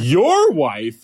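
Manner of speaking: astonished retold question; incredulous echo. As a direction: throw it back in comic disbelief. Incredulous reaction to married counselor.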